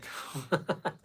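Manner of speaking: light, rising chuckle; laugh. Real, not acted. Laughs after dropping work talk.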